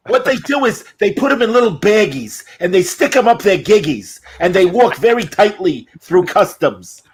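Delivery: high comic drug dealer voice; Gilbert Gottfried. Acted. Big Chicken telling Gavin about smuggling, Gottfried impression. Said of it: "they mentioned on Gilbert Gottfried".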